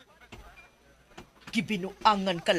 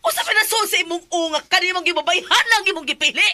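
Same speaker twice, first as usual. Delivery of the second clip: louder, higher furious scolding; angry yelling; 8 semitones higher, 4 dB louder than usual. Acted. Mother berating Robert over his choice, angry yell. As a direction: lash out at your son.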